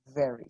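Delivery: "Very" with teeth on the lip and a little air; "Very" with a soft, F-like V; breathy V. Shown as a demonstration. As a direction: let some air out on the V of "Very". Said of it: "suena como un poquito de aire".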